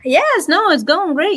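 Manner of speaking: high-pitched sarcastic cheer. Acted. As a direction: say it's great while meaning the opposite. Teasing co-host about yawning.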